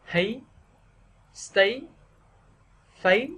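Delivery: "thấy, stay, phấy" each swept upward, separated; rising sắc tone. Shown as a demonstration. Teacher drilling stressed syllables for learners, rising tone. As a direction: lift every syllable high. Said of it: "đọc thêm dấu sắc".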